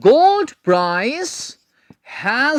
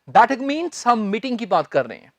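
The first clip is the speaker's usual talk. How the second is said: ordinary delivery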